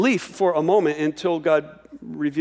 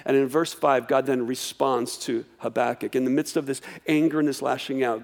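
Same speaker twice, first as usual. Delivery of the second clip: usual speaking voice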